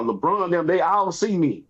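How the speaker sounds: normal talking voice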